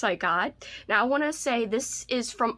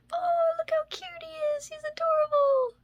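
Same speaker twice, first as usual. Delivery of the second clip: much higher squeaky gush over something cute; gushing squeal. Real, not acted. Showing viewers cute Halloween magnets, high gushing squeal. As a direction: coo at it like a tiny pet.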